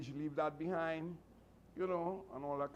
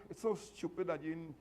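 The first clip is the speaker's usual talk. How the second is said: normal talking voice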